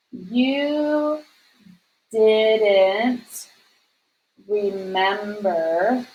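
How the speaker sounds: drawn-out words with long silences between them; word-by-word dictation. Shown as a demonstration. Teacher writing a tag-question example for students, very slow word-by-word dictation. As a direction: speak at the pace of your handwriting.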